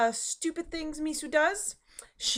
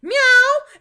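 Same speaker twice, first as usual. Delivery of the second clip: high, loud cat yowl; demanding cat; 12 semitones higher, 12 dB louder than usual. Retold or imitated. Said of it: "she comes running back".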